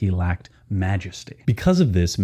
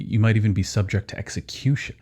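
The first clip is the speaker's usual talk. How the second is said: ordinary talk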